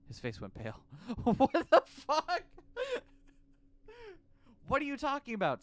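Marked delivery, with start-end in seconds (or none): 1.0-3.0 high squealing laugh; laughing in shock. Real, not acted. 4.7-5.6 high pressing question; demanding. Acted. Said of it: "Sasayama demanded".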